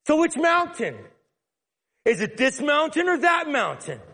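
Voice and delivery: hammed-up deflecting woman; animated woman. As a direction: push the debate to dodge. Woman changes subject to worship.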